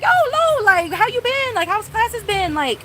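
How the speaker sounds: high, bright, eager hello to a campus friend; bubbly greeting. Pretend. Greeting a classmate seen again on campus, excited and high. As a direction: squeal a friendly catch-up. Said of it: "be like".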